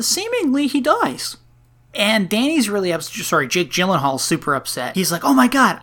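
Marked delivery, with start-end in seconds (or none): 5.2-5.8 mock shock. Retold Jake Gyllenhaal upset.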